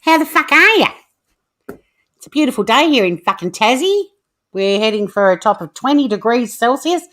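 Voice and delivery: hammed-up broad Australian Bogan voice. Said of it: "Do the Bogan voice again".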